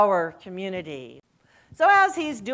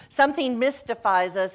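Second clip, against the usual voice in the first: normal talking voice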